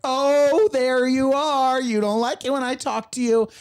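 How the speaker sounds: put-on high, slow, sing-song baby talk to a dog; mock baby talk. Neighbor cooing at her dog, high sing-song baby talk. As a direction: coo at the dog like an overeager neighbor. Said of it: "And they're just like".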